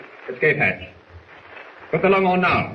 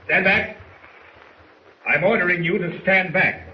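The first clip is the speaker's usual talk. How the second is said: sharp raised command, higher pitched; firm order; 8 semitones higher than usual. Acted. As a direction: bark it like a commander losing control. Commander orders officer back.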